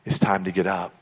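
low, soft wake-up voice; gentle parent. Acted. Parent getting children up for church, gentle and soft. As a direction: coax a sleeping child, hushed. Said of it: "what any parent possibly said to their little children".

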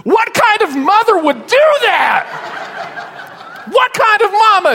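put-on very high, slow, loud wailing child voice; hammed-up child's outraged wail; bawling little girl. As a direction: imitate a daughter breaking into sobs. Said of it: "and she goes".

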